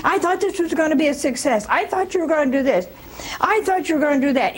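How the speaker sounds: fast, high nagging whine; nagging spouse. Retold or imitated. Nagging wife reproaches her husband, fast and high. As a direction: rattle off complaints like a nagging wife. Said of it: "who keeps nagging".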